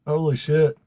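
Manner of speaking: normal talking voice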